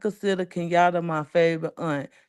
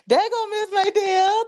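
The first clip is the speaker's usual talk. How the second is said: high, bright-eyed call to a friend; light up as she arrives in the chat; high excited hello to a supporter; excited greeting; 3 dB louder than usual. Real, not acted.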